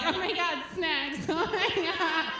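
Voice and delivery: high mocking squeal with a laugh; mock airhead gushing. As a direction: parody a thin girl gasping over snacks. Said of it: "Skinny bitches are like".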